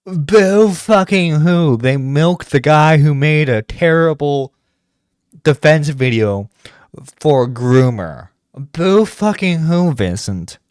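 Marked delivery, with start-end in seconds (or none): 0.0-1.8 slow, drawn-out sarcastic boo hoo; mocking sarcasm. Real, not acted. Mocking the guy's complaint, slow sarcastic. 8.5-9.9 slow, drawn-out sarcastic boo hoo; mocking sarcasm. Real, not acted. Mocking the guy's complaint, slow sarcastic.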